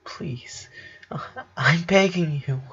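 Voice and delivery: soft, desperate plea; desperate begging. A narrator's reading. Lincoln begging his sister Lori, soft and desperate. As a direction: beg her with all he has left. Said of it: "he asked almost desperately".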